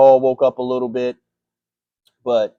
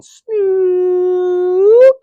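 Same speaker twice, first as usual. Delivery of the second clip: long, high, loud drawn-out call; stretched, triumphant yell of the pick name; 9 dB louder than usual. Real, not acted. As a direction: belt the name out like a hype chant. Calling out his own pick name.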